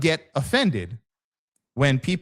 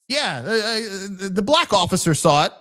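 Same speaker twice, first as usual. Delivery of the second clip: higher-pitched, animated bystander report; insistent witness; 7 semitones higher, 3 dB louder than usual. Acted. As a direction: imitate an eager witness pointing someone out. Voices an eager witness on scene.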